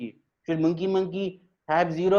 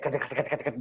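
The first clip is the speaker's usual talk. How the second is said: quieter rapid "kacha-kacha" babble; imitated animal chatter; 3 semitones lower, 6 dB quieter, much faster than usual. Animals gossiping at their meeting, chattering. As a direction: chatter softly like a crowd of animals.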